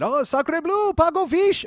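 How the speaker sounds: high, loud cartoon Frenchman; over-the-top French accent. French guy in little sketch, exaggerated French accent. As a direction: exclaim in a hammed-up French accent. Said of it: "let's do a little sketch".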